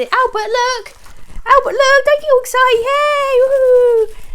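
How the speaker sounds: very high, loud party cheer; excited cheering. Real, not acted. Owner cheers to her dogs after a pop, high loud whoops. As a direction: whoop it up to make the bang fun.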